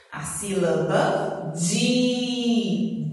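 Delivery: very slow, with a long held "di"; drawn-out syllable. Shown as a demonstration. Teacher giving children the answer, drawn-out syllable. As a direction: stretch "di" out for learners to catch.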